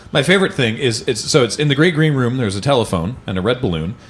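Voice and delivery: ordinary talk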